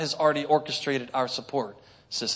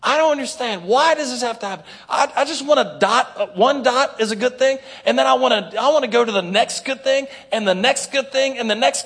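hammed-up self-pitying prayer; whiny complaining prayer; 6 semitones higher than usual. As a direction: complain to God like a sulking child.

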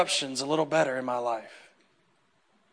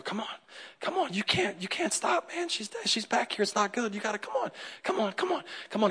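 high, hushed, pressing urgency; urgent pleading; 4 semitones higher, 10 dB quieter than usual. Retold or imitated. Jairus urging Jesus not to stop, anxious high hush. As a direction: hurry him along, anxious and tight. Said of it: "Jairus is there going".

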